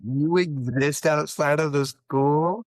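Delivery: slow, high, loud disbelief; mock astonishment. Pupil spotting teacher at the grocery store, mock astonishment. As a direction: stretch it out like a stunned pupil.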